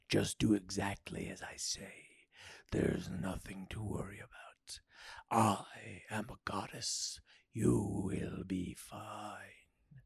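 slow, low, hushed voice; soft, breathed-out divine calm; soothing goddess. A narrator's reading. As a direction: reassure him in a slow whisper. Goddess reassures the frightened man.

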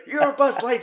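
high-pitched loud cry of you're Buzz Lightyear; pleading yell in a high voice; high shouted insistence. Retold or imitated. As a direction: shout it up high to snap him out. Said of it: "And it's like".

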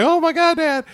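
very high mock squeal; put-on thrilled teenage gushing; excited daughter. Daughter gets a new phone from dad, excited.

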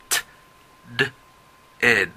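"T, d, ed" said as three separate model sounds; teacher drills the three endings. Shown as a demonstration. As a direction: model "T", "d", "ed" one by one. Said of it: "practiquen las tres pronunciaciones".

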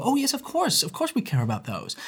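high, soft, placating agreement; softly dismissive imitation of polite agreement; mock easy agreement. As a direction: mimic people agreeing too easily, high and hushed. Acting out people's easy agreement.

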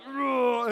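loud, high mock grunt; hammed-up brutish grunt; imitated grunt. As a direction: grunt like the hulking stranger. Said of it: "here's this dude all like".

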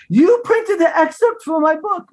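put-on high, delighted Italian-accented exclamation; lovely Italian accent. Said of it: "with his lovely Italian accent".

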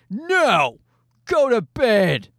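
high, drawn-out mock command; mock refusal. Dad pretends to refuse his pleading toddler at bedtime, mock stern.

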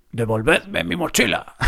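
hammed-up tough fighter voice. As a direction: play the action-movie hero threatening. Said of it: "puse mi voz de luchador". (voz de luchador exagerada)

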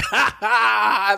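high, put-on ha ha; mock laugh. Shown as a demonstration. Joker topping his own joke, high mock laugh. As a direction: laugh off your own punchline. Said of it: "and just say".

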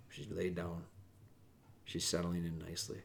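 hushed quiet voice. Real, not acted. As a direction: drop to a soft, hushed voice so the dog stays settled. Softened voice as dog settles.

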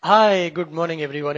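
normal talking voice

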